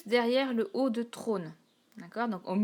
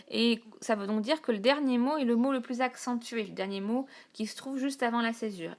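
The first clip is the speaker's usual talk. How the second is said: ordinary talk, nothing marked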